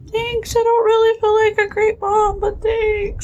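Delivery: high-pitched weepy whine, played for laughs; mock tearful. Mother retelling thanking her friend, mock tearful. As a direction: imitate yourself crying through a thank-you. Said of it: "I'm, like, crying".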